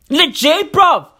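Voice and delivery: usual speaking voice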